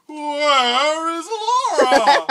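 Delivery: drawn-out desperate yell of the name; anguished shout. Acted. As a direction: cry it out slow, panicked and loud. Legolas finds Laura kidnapped.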